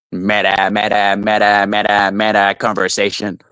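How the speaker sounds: low monotone droning impression; Ruby. Shown as a demonstration. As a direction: drone the repeats in a low, unvarying voice. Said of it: "this is how you do a Ruby impression".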